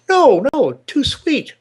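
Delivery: very high comic squeak for the punchline; punchline of a joke, a high hammed-up squeal. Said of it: "He says".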